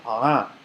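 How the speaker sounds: "ah" from the throat, as ain; Arabic ain sound. Shown as a demonstration. Teacher demonstrating ain from the throat for students. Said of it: "like that from the throat".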